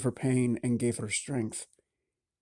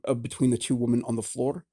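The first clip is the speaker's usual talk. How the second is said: ordinary delivery, nothing marked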